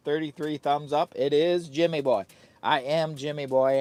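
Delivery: ordinary talk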